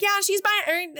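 high, chirpy statue voice; talking statue. Retold or imitated. A living statue gives Alex away, high chirpy voice. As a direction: squeak it out like a tattletale statue. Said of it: "the pillar comes to life and is like".

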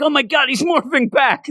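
high, startled voice of a freaked-out guy; panicked quote. Retold or imitated. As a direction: squeal it out like he's panicking. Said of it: "where he's like".

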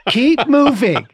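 high, loud nagging gadget; watch alert voice. Retold or imitated. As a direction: call it out like an insistent little watch. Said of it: "the little thing says".

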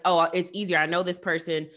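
usual speaking voice

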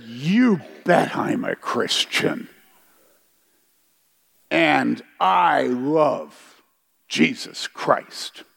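slow, deliberate confession of faith; slow sincere declaration. Retold or imitated. Answering an angry crowd, slow and unshaken. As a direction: let each word land, firm and sincere.

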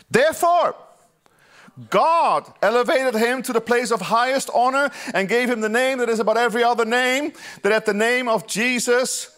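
high, loud, slow at the peaks; preaching at full force. Acted. Pastor exalting Jesus' name before the congregation, full-force preaching. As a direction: lift it to a shout of praise. Preaching Philippians hymn climax.

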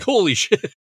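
laughing, high startled yelp. Retold or imitated. Shelley startled by Gordon's greeting, laughing yelp. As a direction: jump at the greeting. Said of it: "physically jumps, like".

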